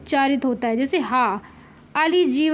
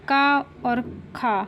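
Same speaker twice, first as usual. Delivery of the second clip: slow contrast demo; 2 semitones lower, 3 dB quieter, much slower than usual. Shown as a demonstration. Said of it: "पहला और दूसरा".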